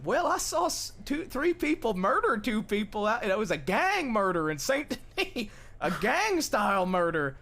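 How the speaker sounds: an eager stranger spilling a gang murder story, excited and loud; excited blurting. Retold or imitated. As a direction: blurt out the news, all wound up.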